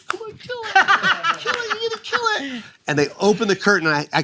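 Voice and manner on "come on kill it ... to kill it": very high squealed cheerleading; imitated sister. Sister urging him on before the curtain, high mock squeal. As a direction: squeal encouragement in a mock falsetto. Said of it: "My sister's like".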